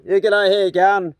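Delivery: lightly voiced cheery taxi driver, slow; a hint of a chatty cabbie; taxi driver greeting. Driver greeting two hitchhikers in the back, mock cheery. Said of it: "I felt like a taxi driver".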